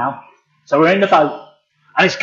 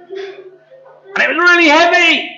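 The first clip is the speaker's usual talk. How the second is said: straining; 9 semitones higher, slower than usual. Acted. Acts hauling a heavy net.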